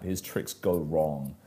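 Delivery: usual speaking voice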